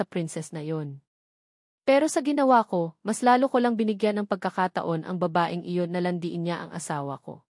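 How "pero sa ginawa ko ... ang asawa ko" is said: usual speaking voice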